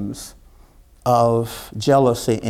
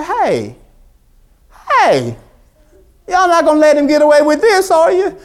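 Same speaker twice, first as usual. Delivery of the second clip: high, loud and fast, fired up; rally the soldiers with youthful heat; eager youngster; 13 semitones higher, 8 dB louder than usual. Retold or imitated. Young David urging the scared army, loud and high. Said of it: "David said".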